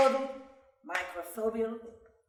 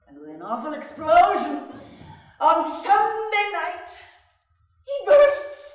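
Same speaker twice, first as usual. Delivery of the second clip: climbing higher and louder to a laughing burst; from tense to an explosive laughing climax; building to a burst; 11 semitones higher, 13 dB louder than usual. Acted. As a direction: blow up with him at the end. Trump exploding, narrator builds up.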